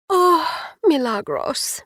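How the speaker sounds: a slow, high, grieving sigh of her name. A narrator's reading. Livy at Milagros's bedside, slow grieving sigh. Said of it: "now I was sobbing again".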